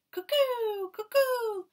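very high, loud cuckoo call; the cuckoo clock calling, very high and loud. A narrator's reading.

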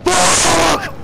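very high, loud startled curse; terrified shout. Real, not acted. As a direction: yell as it appears again. Monster jumps out.

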